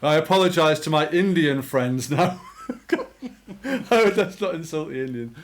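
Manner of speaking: laughing through an apology. Real, not acted. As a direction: apologize while cracking up. Laughing apology after joke.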